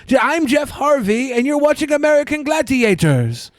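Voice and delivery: hyped TV announcer, pitched high; big show host intro. Retold or imitated. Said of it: "that voice is like".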